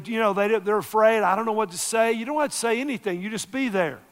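loud, high impassioned preaching; preaching at full force. Real, not acted. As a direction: build to a fiery sermon climax. Preaching climax.

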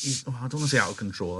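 normal talking voice

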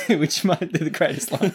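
laughing through the words. Real, not acted. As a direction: chuckle while saying it. Laughing at own pun tagline.

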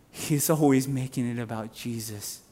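usual speaking voice

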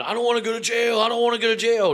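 high, pleading cry of a caught man; panicked screaming. Retold or imitated. Caught shoplifter begging in a headlock, high panicked plea. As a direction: beg not to go to jail. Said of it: "like, screaming".

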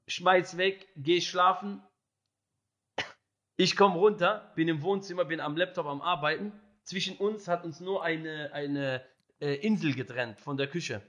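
ordinary talk

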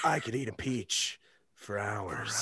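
low, slow, hushed creep; soft, low creepy-actor impression; creepy Nicolas Cage. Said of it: "creepy Nicolas Cage".

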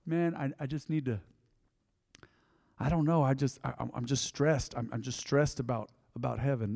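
mock-fretful mumble. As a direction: play a silly stressed believer, mumbling. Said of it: "I walked around going".

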